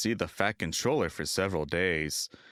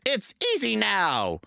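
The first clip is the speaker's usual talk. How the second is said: gleeful; 12 semitones higher, 4 dB louder than usual. A narrator's reading. James gloating.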